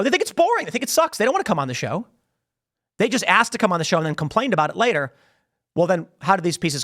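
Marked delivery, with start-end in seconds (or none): none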